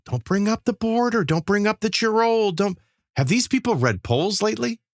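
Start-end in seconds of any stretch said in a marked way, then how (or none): 0.0-2.8 high, mocking list of don'ts. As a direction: sneer out each forbidden topic. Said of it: "follow the wording in this".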